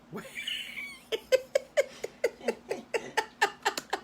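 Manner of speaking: long high rising laugh; storyteller laughing at her own joke. Real, not acted. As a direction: break into a real high laugh. Storyteller cracks up.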